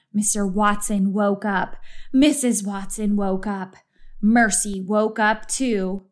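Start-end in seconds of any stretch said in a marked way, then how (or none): none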